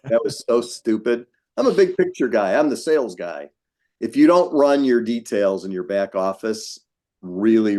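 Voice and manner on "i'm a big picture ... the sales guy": mocking quote. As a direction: mimic a foolish self-description, pitched up. Mocking his younger self's line.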